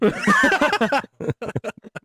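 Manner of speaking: bursting out laughing; loud high laugh. Real, not acted. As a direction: cackle at your own joke. Laughing at own impression.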